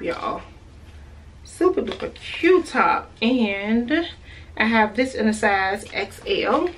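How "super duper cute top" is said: super enthusiastic gush; excited repeat. Shown as a demonstration. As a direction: sell it with a bigger second pass.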